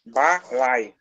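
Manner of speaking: low "Balai" split, weight on LA; stress on LA. Shown as a demonstration. Teacher repeats the word for class, stress on LA in "Balai". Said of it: "A sílaba forte passa a ser qual".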